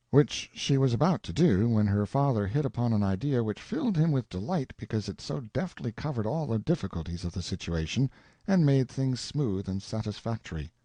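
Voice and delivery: plain reading voice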